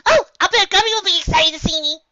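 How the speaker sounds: very high, loud bubbly voice; Pinkie Pie voice. Acted. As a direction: hyper party pony, squeaky.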